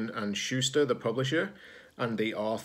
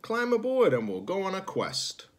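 higher, hearty invitation; cheery captain; 10 semitones higher than usual. Acted. The captain welcoming Zed aboard, higher and cheery. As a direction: call them aboard brightly. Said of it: "said the captain".

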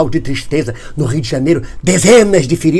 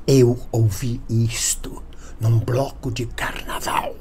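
whisper; 4 semitones lower, 7 dB quieter, slower than usual. Pretend. Conspiratorial aside after the song.

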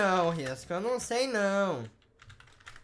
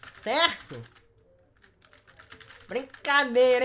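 usual speaking voice

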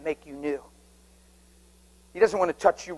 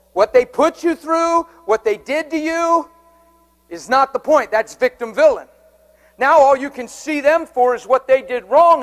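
higher, louder, driving sermon; forceful preaching; 7 semitones higher, 5 dB louder than usual. Real, not acted. Preacher hammering victim and villain at the church. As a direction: press it home to the congregation.

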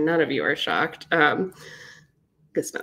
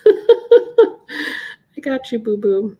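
laughing; 5 semitones higher, 8 dB louder, much slower than usual. Real, not acted. Laughing promise to viewers.

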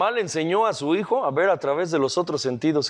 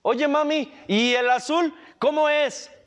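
put-on curious child; 8 semitones higher than usual. Child asks mother in story.

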